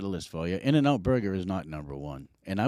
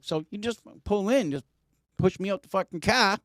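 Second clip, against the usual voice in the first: pitched high, urgent, animated; emphatic high instruction; 11 semitones higher than usual. Retold or imitated. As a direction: spell it out urgently. Retold instructions to wife.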